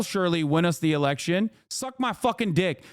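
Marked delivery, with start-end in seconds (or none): none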